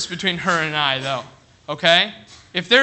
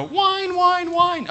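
high, nasal-free mock whine, much higher; mock whining; 10 semitones higher, much slower than usual. Preacher mocking adults who whine to God, high mock whine. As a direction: whine it like a sulky child.

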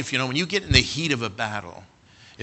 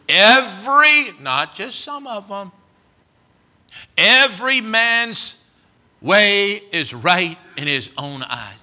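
preaching emphasis; 7 semitones higher, 9 dB louder, slower than usual. Acted. Preaching at full force.

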